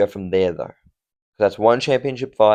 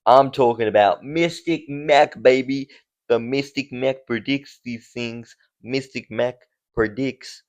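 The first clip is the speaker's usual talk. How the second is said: mock Irish fight-promo brag in a bad accent; 3 semitones higher than usual. Australian vlogger hamming a bad Irish accent as Mystic Mac. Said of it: "Oh, this is a terrible accent".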